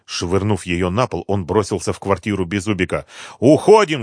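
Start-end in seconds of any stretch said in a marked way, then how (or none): 3.4-4.0 loud, high alarm shout. A narrator's reading. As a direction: shout the order to flee. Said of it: "закричал он".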